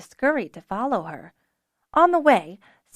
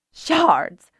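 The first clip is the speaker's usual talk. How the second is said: louder dismayed oath; dismayed exclamation; 4 semitones higher, 6 dB louder than usual. A narrator's reading. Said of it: "Sula exclaimed".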